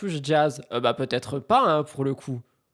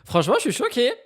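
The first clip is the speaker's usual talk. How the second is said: mock shock; 8 semitones higher, 4 dB louder than usual. Real, not acted. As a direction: pitch jumps up, louder, playing scandalised. Réaction à la vidéo. (choqué pour rire, voix montée)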